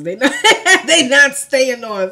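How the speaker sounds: laughing loudly, high, at her lashes. Real, not acted. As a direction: crack up mid-complaint.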